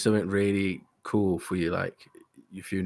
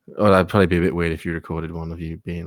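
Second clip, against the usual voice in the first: ordinary talk, nothing marked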